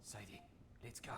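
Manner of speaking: hushed, breathy call; don't let the gunman hear; whispered warning; urgent whisper. Acted. Sneaking away from armed killer.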